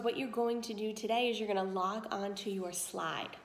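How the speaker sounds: usual speaking voice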